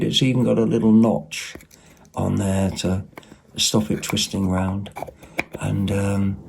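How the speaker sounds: normal talking voice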